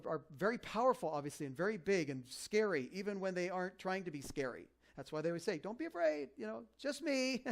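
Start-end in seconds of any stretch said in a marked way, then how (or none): 5.6-7.4 gentle angel voice. Acted. Pastor voicing a gentle angel.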